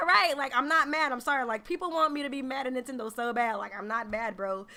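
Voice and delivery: ordinary delivery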